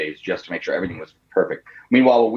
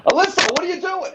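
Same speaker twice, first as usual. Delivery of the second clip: high mock dad voice; 3 dB louder than usual. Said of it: "And he's like".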